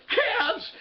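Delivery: very high-pitched delighted call; hammed-up elated greeting; joyful cry. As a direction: cry out at seeing your children. George greets his children.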